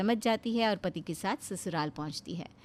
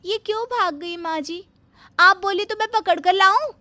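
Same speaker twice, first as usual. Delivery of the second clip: high and much louder, overeager; eager loud bahu; 11 semitones higher, 9 dB louder than usual. Acted. Bahu offering to drag back the fleeing friend, loud and keen. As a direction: shout the offer brightly.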